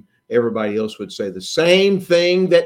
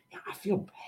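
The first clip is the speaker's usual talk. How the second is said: whisper; 6 semitones higher, 12 dB quieter than usual. Retold or imitated. Disciples whispering by the fire.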